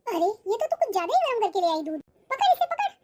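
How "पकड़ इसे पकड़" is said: much higher sudden cry, take it; pained yelp. Acted. Grandmother burnt by hot milk, crying out much higher. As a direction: yelp as the hot glass burns you.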